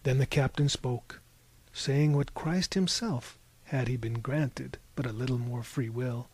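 plain reading voice

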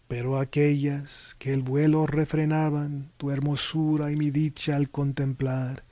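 measured Spanish verse recital; declaimed Spanish poem, settling at the close; poetry recitation. Acted. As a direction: recite the verse, slowing on the final line.

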